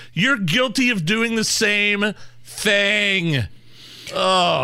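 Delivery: high, drawn-out indignation; exasperated accusation. Real, not acted. Exasperated accusation after played clip.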